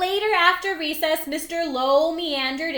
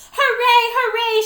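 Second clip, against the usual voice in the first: high, loud cheer; shouted hooray; 8 semitones higher, 5 dB louder than usual. A narrator's reading. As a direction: shout it with glee. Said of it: "she shouted".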